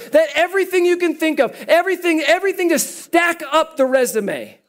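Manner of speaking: high, loud and fired up, half shouting; excited preaching. Real, not acted. Pastor hammering garbage home to the congregation, loud and excited. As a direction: drive the point home at full force.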